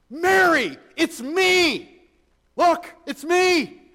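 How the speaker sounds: very high, loud attention-grabbing yell; mock shout. How Jesus doesn't reveal himself to Mary, mock excited shouting. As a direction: holler it as an over-eager reveal. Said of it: "He doesn't say that".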